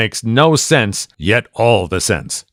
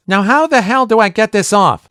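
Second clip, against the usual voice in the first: put-on little boy; 10 semitones higher than usual. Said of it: "and says".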